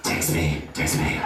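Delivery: deep mock-seductive text me; low pleading. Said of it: "dropping a text going".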